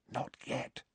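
very soft whisper in the ear; hushed, barely voiced; breathed whisper. A narrator's reading. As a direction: whisper an urgent warning. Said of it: "she breathed in his ear".

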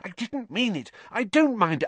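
high, flustered backtracking; hurried, anxious high-pitched excuse; frantic. Acted. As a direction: take it back in a panic. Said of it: "Hermione whispered frantically".

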